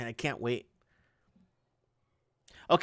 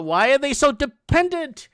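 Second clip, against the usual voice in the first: emphatic; 9 semitones higher, 9 dB louder than usual. Real, not acted. Rhetorical outrage at digitization.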